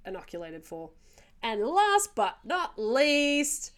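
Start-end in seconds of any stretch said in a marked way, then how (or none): 1.4-3.7 grand drawn-out announcer voice; big game-show reveal, slowly; mock announcer. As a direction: stretch it out high and loud like a showy introduction. Announcing the final danger.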